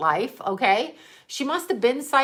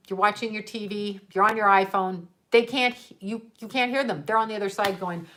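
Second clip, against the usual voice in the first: normal talking voice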